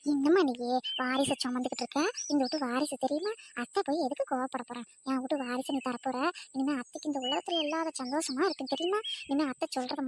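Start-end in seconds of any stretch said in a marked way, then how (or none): none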